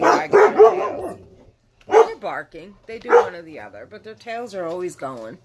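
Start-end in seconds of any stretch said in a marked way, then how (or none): 0.0-3.9 excited. Real, not acted. Enthusing about her dogs.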